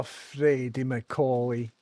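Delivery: normal talking voice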